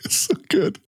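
high-pitched, real amused laughter in the words; laughing. Real, not acted. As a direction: squeeze it out through real laughter. Cracking up over pigeon commercial.

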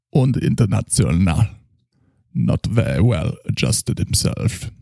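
put-on slow German accent with z for th; a drunk Freudian doctor boasting. Drunk German psychoanalyst character.

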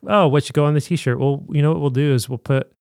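normal talking voice

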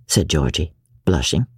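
ordinary delivery, nothing marked

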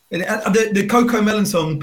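ordinary talk

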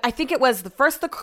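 ordinary talk, nothing marked

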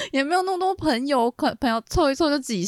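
high, half-laughing whine; mock whine. Re-acts her younger self, laughing.

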